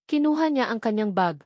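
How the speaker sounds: ordinary delivery, nothing marked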